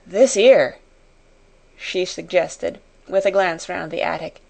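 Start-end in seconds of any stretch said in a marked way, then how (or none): none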